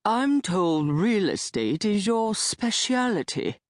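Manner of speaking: specialty said the English way, spe-shee-al-i-tee; old foreigner stressing specialty in British fashion. Acted. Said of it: "pronounced the word specialty like they do in England".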